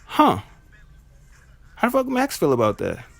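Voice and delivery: high-pitched, incredulous questioning; puzzled disbelief. Retold or imitated. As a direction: react like the news makes no sense. Acting out his puzzled reaction to news.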